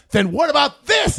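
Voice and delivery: high triumphant shouted question; hammed-up gotcha; mock gotcha shout.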